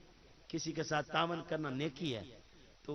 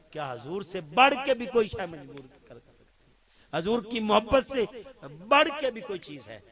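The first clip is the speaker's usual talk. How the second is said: impassioned preaching shout; 4 semitones higher, 9 dB louder than usual. Real, not acted. Preacher's rising rhetorical climax.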